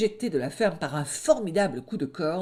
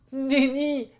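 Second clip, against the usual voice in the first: high, proclaimed no; high loud wolf fib; loud denial; 9 semitones higher, 4 dB louder than usual. Acted. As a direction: deny it boldly. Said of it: "clama le loup".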